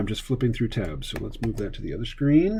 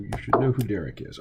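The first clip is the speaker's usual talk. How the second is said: usual speaking voice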